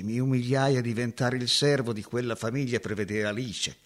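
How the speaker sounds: usual speaking voice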